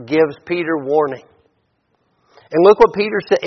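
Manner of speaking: usual speaking voice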